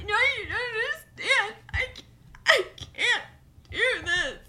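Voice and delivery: high, teary, half-laughing wail; mock crying bride. Bride to hairdresser, mock sobbing again. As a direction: imitate the bride breaking down.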